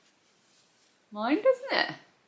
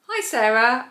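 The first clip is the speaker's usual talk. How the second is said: high, louder greeting; podcasting voice; 6 semitones higher, 7 dB louder than usual. Real, not acted. Vlogger greeting a friend by voice note, podcasting voice. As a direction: open a voice note in an upbeat presenter voice. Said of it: "leaving me a voice note with her podcasting voice".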